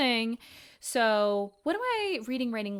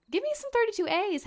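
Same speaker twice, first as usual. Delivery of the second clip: jumps way up high and loud on me; excited high-pitched plea; high emphatic demand; 13 semitones higher, 5 dB louder than usual. Real, not acted. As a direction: squeal the request, half joking.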